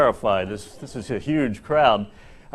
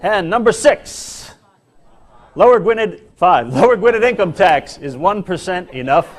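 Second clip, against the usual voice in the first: higher, projected game-show style delivery; big comic top-ten reveal; countdown announcer; 8 semitones higher, 4 dB louder than usual. Acted. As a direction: announce each entry with mock grandeur.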